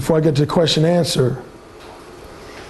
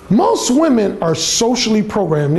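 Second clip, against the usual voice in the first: ordinary talk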